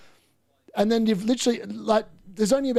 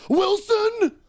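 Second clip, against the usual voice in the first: much higher shout of the name; excited yell; 14 semitones higher than usual. Real, not acted. Fan watching Wilson receive the ball, excited yell. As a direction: leap up as he gets the ball. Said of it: "I get excited".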